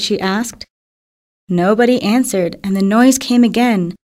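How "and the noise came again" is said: plain reading voice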